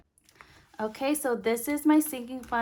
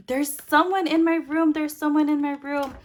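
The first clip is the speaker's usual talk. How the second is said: put-on higher and louder, a frightened kid repeating himself; scared child's voice; 6 semitones higher, 7 dB louder than usual. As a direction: imitate her son, panicked and close to tears. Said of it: "he looked like he was going to cry".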